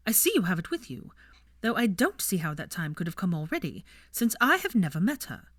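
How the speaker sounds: mother rebuking son over the ring; scornful mother. A narrator's reading. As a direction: scoff and press him hard. Narcissa's scoffing rebuke.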